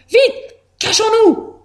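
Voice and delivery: high, urgent drawn-out call; panicked. A narrator's reading. Hamster to friends as the fox comes, high and panicked. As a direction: urge them to hide in panic.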